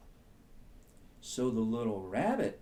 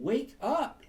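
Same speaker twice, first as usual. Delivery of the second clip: much higher, bright and chirpy; squirrel voice; 14 semitones higher, 5 dB louder than usual. Acted. Squirrel telling the rabbit to wake, high and quick. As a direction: squeak it out like a squirrel. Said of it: "said the squirrel".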